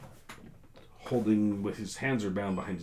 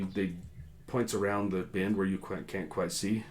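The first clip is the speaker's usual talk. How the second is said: ordinary talk, nothing marked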